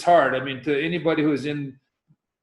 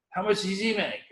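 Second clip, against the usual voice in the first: higher imitated Australian accent with a laugh; Aussie accent; 6 semitones higher than usual. Aki asking a kid about his brother's pay, Aussie accent. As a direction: ask it in a mock Aussie accent. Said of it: "This is my best Aussie accent".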